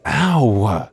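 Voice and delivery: ordinary talk, nothing marked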